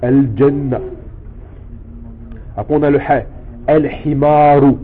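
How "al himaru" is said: slow al-himaru, lam clearly sounded; al-himaru said slowly. Shown as a demonstration. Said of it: "le lam se prononce". (al-himaru lentement, lam prononcé)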